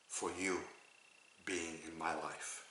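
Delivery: soft, low, trailing gratitude; tender thanks. Shown as a demonstration. Teacher models being thankful for someone, soft and tender. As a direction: let it sink to a hush.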